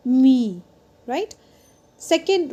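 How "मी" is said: "मी" louder with the vowel held long. Shown as a demonstration. Teacher demonstrating an open syllable, "मी" with a long vowel. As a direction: stretch the ee in "मी". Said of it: "वो long जाएगी".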